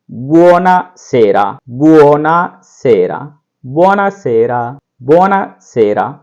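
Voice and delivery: buonasera drawn out syllable by syllable; repeat-after-me drill; slow model word. Shown as a demonstration. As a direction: stretch buonasera slowly, over and over. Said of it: "इस तरीके से इसका उच्चारण करेंगे".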